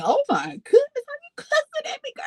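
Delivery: high, teary mock whimper; retold crying. Daughter hurt by father's cussing, teary. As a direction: act out tearful hurt. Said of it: "I'm on the phone crying".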